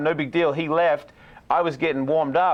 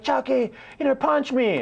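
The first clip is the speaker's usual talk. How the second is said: put-on high, eager drunk begging; a giddy drunk fan egging on a fighter; drunken pleading; 9 semitones higher than usual. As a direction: beg your hero to hit you.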